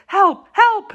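very high, panicked cry for help; hoarse scream. A narrator's reading. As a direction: scream for rescue in terror. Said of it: "she screamed out in a hoarse voice".

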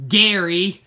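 loud, laughing greeting to a toy; laughing callout. Pretend. Greeting a toy as if it were Gary, in a loud laughing shout. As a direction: hail the toy like an old friend with a laugh.

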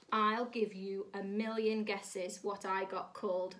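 ordinary delivery, nothing marked

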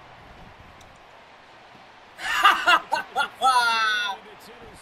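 loud high cackling laugh; loud laughter. Real, not acted. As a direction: burst out laughing. Gamer laughs hard.

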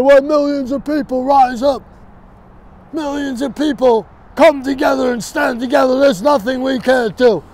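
lightly voiced rally speech; mock Bernie speech. Quoting Bernie's rally line back at him, mildly mocking. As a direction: parrot his stump speech with a touch of pomp. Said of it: "You keep saying".

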